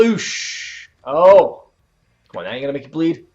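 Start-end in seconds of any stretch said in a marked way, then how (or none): 0.0-0.9 pained wince. Real, not acted. Reacting to glass spot. 1.0-1.7 surprised shout. Real, not acted. Reacting to match.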